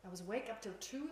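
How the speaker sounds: ordinary delivery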